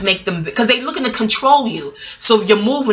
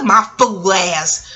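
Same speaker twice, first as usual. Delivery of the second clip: ordinary talk, nothing marked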